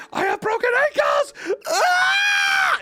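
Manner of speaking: very high, loud sobbing shout; mock crying yell. Skier wailing on the ice, high sobbing mock shout. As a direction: wail like your legs just snapped. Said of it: "And he's like".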